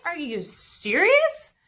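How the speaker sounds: high, slow, outraged disbelief; indignant disbelief. Retold or imitated. David hearing Nabal's insult, high and outraged. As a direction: drag out serious in high disbelief. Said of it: "He's like".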